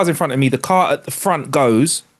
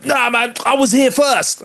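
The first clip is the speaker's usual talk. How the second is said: whiny other driver; 11 semitones higher, slower than usual. Retold or imitated. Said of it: "'Cause his car was clapped".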